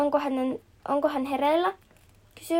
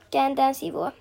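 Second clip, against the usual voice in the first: ordinary delivery, nothing marked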